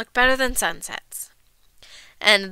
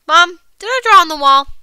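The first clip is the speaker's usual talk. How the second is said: put-on higher, louder little-girl question; pestering child; 5 semitones higher, 7 dB louder than usual. A narrator's reading. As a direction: kid nagging mom with a question. Bored child pesters mother.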